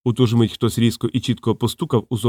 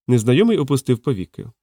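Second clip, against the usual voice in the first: usual speaking voice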